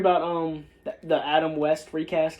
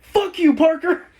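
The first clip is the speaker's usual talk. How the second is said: high, loud laughing yell; mock yell; 11 semitones higher, 7 dB louder than usual. Shout it high through a laugh. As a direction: Deadpool yelling at Parker as he hits the guy, hammed-up shout. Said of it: "he's like".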